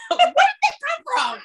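bursting into surprised laughter; laughing. Real, not acted. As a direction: laugh through the question. Sudden laugh at pulled-out tissue.

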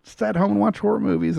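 high, quick mock impression of Katie; lightly mock a coworker's protest, high and fast; imitating Katie. Podcast host mocking coworker Katie's protest, high quick impression. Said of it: "She's like".

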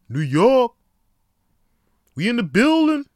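shouted high and loud like a hype man; hype catchphrase shout. Shown as a demonstration. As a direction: yell the catchphrase to fire up the crowd. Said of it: "that's just my catchphrase now".

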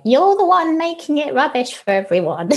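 high, sneering inner critic. Retold or imitated. Inner critic scolding the singer, mock sneer. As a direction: voice the nagging critic mockingly. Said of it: "the voices that come up".

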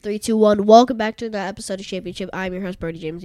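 shouted, fast host countdown and welcome; loud show intro. Real, not acted. Son kicks off the episode for listeners, loud and fast. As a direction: belt it out like a hyped podcast host.

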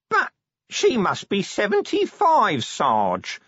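high, drawn-out appalled disbelief; fascinated horror. A narrator's reading. Corporal picturing elderly cleaner on pole, horrified. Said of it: "in fascinated horror".

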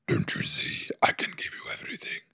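soft, voiceless whisper; mock demon whisper. Imitating the demon's promise to give everything, whispered. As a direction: whisper it like a secret temptation. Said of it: "Why does he talk like that?".

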